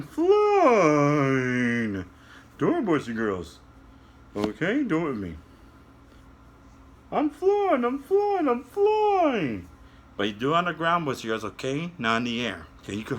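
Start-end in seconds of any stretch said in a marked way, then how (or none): 0.0-2.0 drawn out. Acted. Drawn out flying. 7.1-9.7 high puppet voice. Acted. Puppet voice flying.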